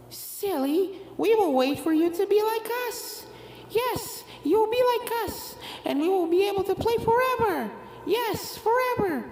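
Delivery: sweetly sinister, forever; creepy ghost twins. A narrator's reading. Ghost twins telling Apple Bloom she will stay forever, creepy.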